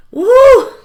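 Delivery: loud high whoop. Retold or imitated. Cheering at the party with a loud high whoop.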